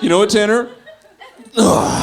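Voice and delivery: put-on be the kid flipping off Tanner; imitated angry kid. Kid snapping at friend, imitated.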